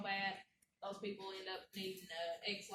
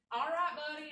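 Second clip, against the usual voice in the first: breezy, higher, louder mock reply; dismissive quote; 6 semitones higher, 5 dB louder than usual. Medic casually answers the driver, dismissive. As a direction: imitate a medic brushing someone off.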